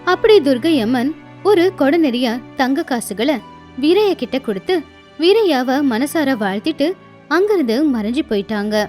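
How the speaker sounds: ordinary delivery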